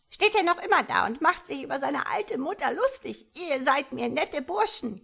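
loud, high indignant frog; scolding shout. A narrator's reading. Frog mother scolds her tadpole, loud and shouting. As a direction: rail at the cheeky youngster. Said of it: "schrie der Frosch".

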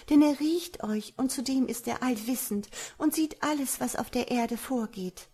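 high-pitched woman's voice, alarmed; young woman, warning. A narrator's reading. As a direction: warn the stranger urgently, voice held high. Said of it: "antwortete sie". (hohe Frauenstimme, besorgt warnend)

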